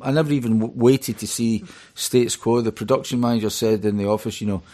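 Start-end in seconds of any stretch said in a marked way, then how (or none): none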